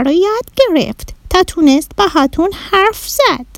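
very high, odd little alien voice; a tiny Martian in broken Persian; cute little alien. Acted. Bahram the Martian introducing himself to the children in a high alien voice.

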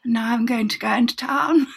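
put-on affect a breathy posh accent over trivial news; teen girl faking posh on a tape recording; trying-to-sound-posh voice. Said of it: "so I'm putting on this posh voice".

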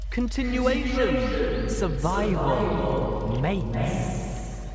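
very slow, high, drawn-out chant; eerie hive voices. A narrator's reading. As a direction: stretch each word like a chorus. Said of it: "The voices responded".

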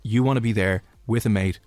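ordinary talk, nothing marked

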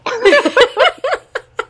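real laugh, rising high; high burst of laughter. Real, not acted. Laughing at her own story.

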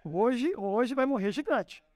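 gleeful order with a laugh; God to the angels, amused and triumphant. Retold or imitated. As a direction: laugh while calling the giant's end. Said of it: "olhou pros anjos e falou assim".

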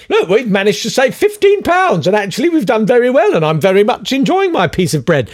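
high, put-on smug voice; mock posh landlord. Podcaster mocking landlords playing poor, high smug voice.

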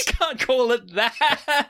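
high, drawn-out words through real laughter; laughing protest. Real, not acted. Cohost objecting to the word leitmotif, helpless laughing protest. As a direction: object while cracking up.

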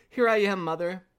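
put-on high, loud little kid's cry. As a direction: call to mother in a small high voice. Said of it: "a little voice cried".

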